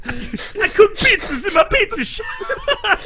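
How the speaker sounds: high, drawn-out mock New York voice; hammed-up high New York pizza man; New York accent. Friend riffing on the dub, mock New York accent. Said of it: "I'm from New York".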